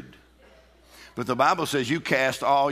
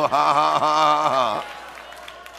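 big deliberate laugh that fades out; defiant demonstrated laugh; much slower than usual. Shown as a demonstration. Preacher cheering on faith in God, forced laugh. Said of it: "You go".

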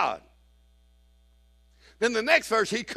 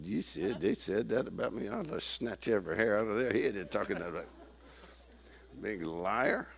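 mock indignant mutter; 4 semitones lower, 10 dB quieter than usual. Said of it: "I said".

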